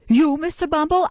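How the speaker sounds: high, loud amazed question. A narrator's reading. Nurse amazed at the beadle's claim, high and loud. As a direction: gasp out his name.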